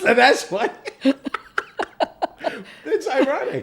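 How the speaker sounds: high, loud words through real laughter; laughing. Real, not acted. To her host about being half Iranian, half Iraqi, laughing. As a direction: crack up while talking.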